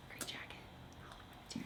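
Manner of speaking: slow soft whisper. Real, not acted. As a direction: breathe it out as an aside. Quiet aside while watching clip.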